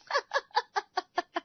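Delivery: high gleeful laugh; loud mischievous laughing; loud laughter. Acted. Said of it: "जोर-जोर से हंसने लगती है".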